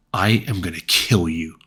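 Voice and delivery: deliberate, heavy threat on "I am gonna kill you"; overplayed menacing line reading; trying to intimidate. Shown as a demonstration. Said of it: "That is me trying to intimidate you".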